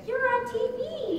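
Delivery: put-on high, laughing child's squeal of delight; excited kid. Stepson spotting mom on TV, squealing with laughter. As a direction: squeal it like an excited six-year-old.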